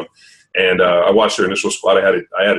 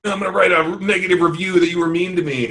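higher, petulant mock voice; mock a touchy client; whiny client imitation; 8 semitones higher than usual. Mimicking whiny client complaint.

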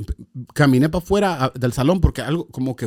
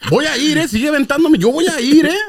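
high, loud, eager "I'm going, eh?"; pushing himself forward, eager and keen; eager promise to God; 12 semitones higher, 6 dB louder than usual. Retold or imitated. Retelling how he answered God, eagerly and high. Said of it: "yo hablándole al Señor".

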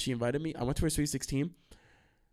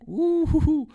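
hooting; 6 dB louder, slower than usual. Real, not acted. Exclaiming over that night.

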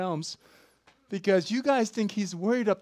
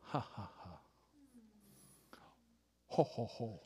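understated divine laughter; 14 dB quieter than usual. Acted. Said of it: "see what I'm doing in heaven".

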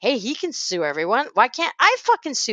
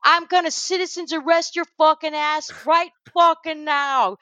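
1 imitating rant; 6 semitones higher than usual. Retelling Rapaport's rant.

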